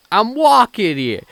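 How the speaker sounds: loud, high, drawn-out New York shout; exaggerated fake New Yorker hollering; terrible New York accent. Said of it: "Let me try that again".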